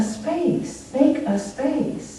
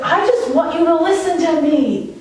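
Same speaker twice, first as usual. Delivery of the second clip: pleading woman; 7 semitones higher than usual. Retold or imitated. Woman voiced in a retold video.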